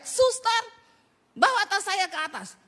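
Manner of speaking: "Suster" diteriakkan tinggi dan keras; anak berteriak. Retold or imitated. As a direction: panggil pembantu dengan teriakan tinggi dan keras. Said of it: "Dia teriak". (anak SMA memanggil suster sambil berteriak keras)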